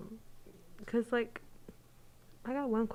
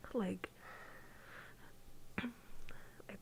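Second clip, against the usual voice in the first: whispering on the phone; 14 dB quieter than usual. Real, not acted. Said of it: "I have to whisper".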